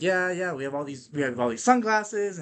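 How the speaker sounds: lightly imitated Spanish accent, slowed pitch; a hint of the hustler's accent selling sunglasses; little accent. Hustler selling sunglasses, light Spanish accent. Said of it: "the little fucker's like".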